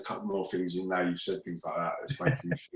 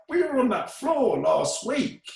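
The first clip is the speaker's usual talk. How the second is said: stunned disbelief; 3 dB louder, slower than usual. Retold or imitated. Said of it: "I'm like".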